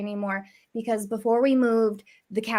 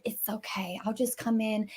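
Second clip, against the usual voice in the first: whisper; 4 semitones lower, 8 dB quieter than usual. Retold or imitated. Sneaking in with hidden kittens.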